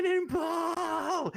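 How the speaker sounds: long, high stretched yell; yelling cannonball. Acted. Satorvik yells while jumping into the river, long high yell. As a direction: hold it out as you leap.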